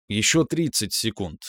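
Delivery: plain reading voice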